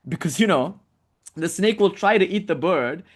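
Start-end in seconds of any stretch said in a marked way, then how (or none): none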